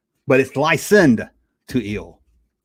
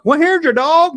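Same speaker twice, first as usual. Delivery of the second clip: other Muppet; 12 semitones higher, 5 dB louder than usual. Retold or imitated. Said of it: "somebody would say".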